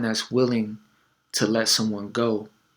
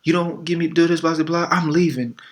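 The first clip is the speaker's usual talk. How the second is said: raised, higher mock outburst; mock angry girlfriend; 6 semitones higher, 3 dB louder than usual. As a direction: play the fed-up girlfriend storming off. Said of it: "she's like".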